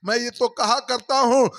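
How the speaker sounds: ordinary talk, nothing marked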